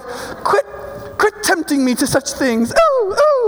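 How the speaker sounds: put-on prissy scolding rising to falsetto squeals; feeble mock protest. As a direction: play a helpless, ineffectual fusser. Mocking feeble swatting at sin.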